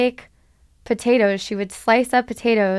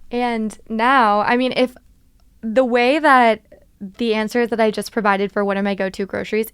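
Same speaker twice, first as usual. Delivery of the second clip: usual speaking voice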